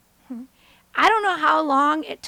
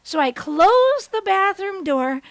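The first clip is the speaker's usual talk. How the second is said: ordinary talk, nothing marked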